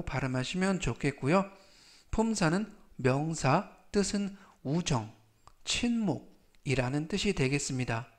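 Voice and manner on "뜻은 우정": ordinary talk, nothing marked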